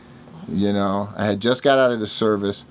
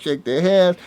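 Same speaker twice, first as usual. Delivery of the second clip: ordinary talk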